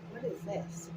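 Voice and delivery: whispered to herself; breathy aside, barely voiced; whispering. Real, not acted. Muttering to herself over card.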